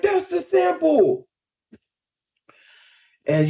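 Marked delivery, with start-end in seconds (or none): 0.0-1.3 much higher, slow stretched cry; higher, slower excited repeat; excited recognition. Retold or imitated. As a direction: repeat the discovery even more amazed. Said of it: "We like".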